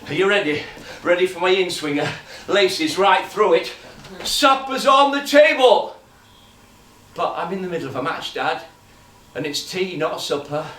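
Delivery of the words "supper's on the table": louder, higher call. Acted. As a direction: call him in from the garden. Dad calling son in.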